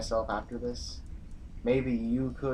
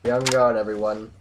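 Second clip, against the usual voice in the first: loud, urgent call to the whole group; a commander shouting a warning to the squad; 11 dB louder than usual. Acted. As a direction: bark the order so everyone hears. General warning the group of enemies.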